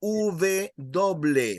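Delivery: slow Spanish "V doble", V said as "uve"; "V doble" in Spanish, slowly: "uve doble". Shown as a demonstration. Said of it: "When you say".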